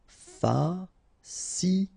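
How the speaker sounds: very slow "fascinant", fa-sci-nant; syllable by syllable. Shown as a demonstration. French teacher modelling for learners, "fascinant" slowly syllable by syllable. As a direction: pause between each syllable of "fascinant".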